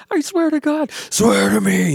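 rough, strained Batman impression. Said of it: "he does that whole thing".

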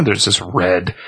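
ordinary delivery

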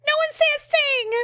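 hammed-up order to shun her; mock scolding league. League members ordering everyone to shun her, high mocking command. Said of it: "They're like".

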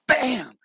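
high-pitched exclaimed "bam"; imitated crash. Running head first into fence, sudden high "bam".

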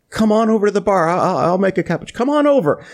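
high-pitched, hurried beckoning; re-enacted eager welcome; eager invitation. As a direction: wave the guest over, voice shooting up. Retells eagerly inviting the star over.

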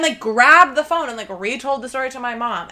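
ordinary talk

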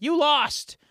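high-pitched, teasing jab; mock taunting. Retelling the villain's taunt to the loser, mock taunting. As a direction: rub it in with a mock-gleeful lift. Joker taunts the losing candidate.

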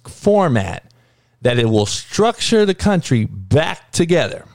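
ordinary talk

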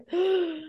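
high reenacted gasp; starstruck gasp. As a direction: gasp in mock amazement. Reenacts oh my gosh moment.

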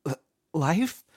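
stammered high, frightened question; shaking. A narrator's reading.

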